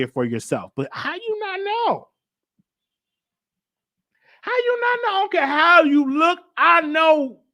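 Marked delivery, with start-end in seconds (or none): 0.9-2.1 mock disbelief. Mocking a clueless partner. 4.4-5.1 mock disbelief, pushier. Repeats question, pushing harder. 5.1-7.4 high, louder put-on voice insisting; mock high voice. Telling viewers how a partner would insist, in a high louder mock voice.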